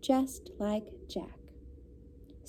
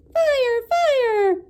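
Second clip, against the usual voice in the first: much higher, loud alarm cry; shouting fire; 13 semitones higher, 8 dB louder than usual. A narrator's reading. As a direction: yell it to scare them off. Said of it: "he cried".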